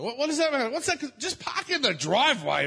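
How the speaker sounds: high, loud, urgent offer; insistent high plea. Retold or imitated. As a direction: push him to park, high and loud. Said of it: "I'm like".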